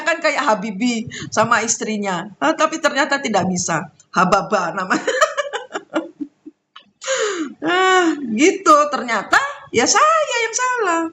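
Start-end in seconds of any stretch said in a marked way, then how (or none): 5.1-6.2 a quick, high burst of laughter. Real, not acted. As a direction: laugh at your own joke. Laughing after joke name. (tawa tinggi singkat)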